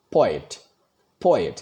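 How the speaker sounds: "Poet" with force on PO; stress on first syllable. Shown as a demonstration. Teacher demonstrating a trochee, "poet" stressed on PO. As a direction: hit the first part of "poet", let the end fall away. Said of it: "पहले वाले पो पे जोर डाला है".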